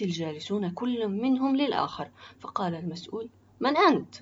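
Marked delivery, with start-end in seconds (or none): 3.6-4.1 high, loud questioning; startled challenge. Acted. Official searches for the unknown voice, startled. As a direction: demand who is speaking, pitched up.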